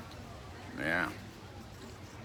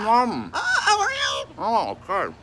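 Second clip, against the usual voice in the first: high loud delighted cry; excited squeal; 14 semitones higher, 7 dB louder, slower than usual. Real, not acted. Cries out while being kissed.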